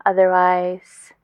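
normal talking voice

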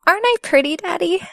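put-on girlish preening question; coy delight. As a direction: ask it bright and eager for praise. Shows off after undressing.

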